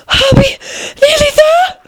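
high, breathless little question; panting. A narrator's reading. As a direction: gasp it out between pants. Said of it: "Alice managed to pant out at last".

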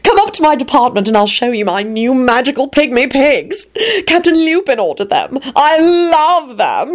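high, gushing and eager; ardent. A narrator's reading. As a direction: gush about the pigs with fervor. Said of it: "he said ardently".

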